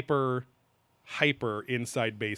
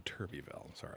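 muttered correction; 5 semitones lower, 16 dB quieter, faster than usual. Real, not acted. Corrects the name quietly.

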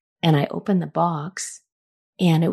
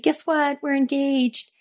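high, bubbling announcement of the engagement; excited news; 9 semitones higher than usual. Retold or imitated. Calling her friend Jan to announce the engagement, excited and high. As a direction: squeal the big news to a friend.

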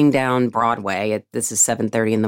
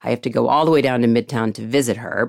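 usual speaking voice

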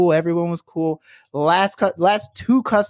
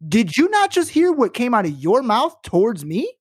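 high, indignant call for all to hear; loud public retort; 7 semitones higher than usual. Retold or imitated. Teller calls out crying customer before everyone, loudly indignant. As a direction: re-enact scolding someone in front of a whole room. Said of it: "said loud so everyone can hear".

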